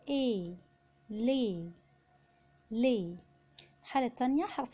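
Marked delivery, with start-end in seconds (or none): 1.1-3.2 "لي" said slowly as French "lait"; "لي" twice, with AI as e. Shown as a demonstration. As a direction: give "لي" one long "ay" vowel. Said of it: "لما يكون جنبه E اتنطق A".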